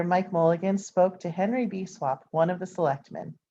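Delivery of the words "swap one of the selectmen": ordinary delivery, nothing marked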